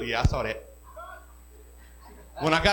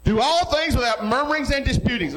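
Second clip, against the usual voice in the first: proclaimed verse; 9 semitones higher than usual. Shown as a demonstration. Pastor proclaims the verse to his church, raised and ringing. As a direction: ring the verse out to the congregation. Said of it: "verse proclaimed, then reread plainly".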